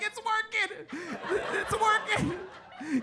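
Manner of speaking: high, slow, overjoyed; a father thrilled it works; proud joy. Retold or imitated. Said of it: "He was like".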